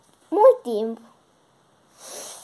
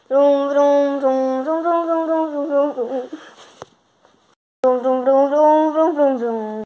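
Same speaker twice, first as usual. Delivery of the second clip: sing-song vroom vroom; car noise; 5 dB louder, much slower than usual. Acted. Girl drives the toy car, chanting vroom vroom. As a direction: chant engine noises playfully.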